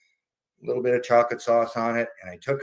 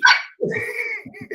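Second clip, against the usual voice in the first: put-on high squeaky old lady giggle; giddy giggle. Mom giggling.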